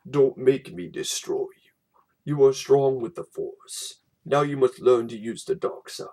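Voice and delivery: low, deep villain reading the storybook; lightly voiced Vader from a storybook. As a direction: menace Luke as Darth Vader. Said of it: "The storybook does the same thing".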